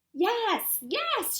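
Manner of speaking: high excited yes; shouted. Acted. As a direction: cheer it eagerly. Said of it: "shouted Grump".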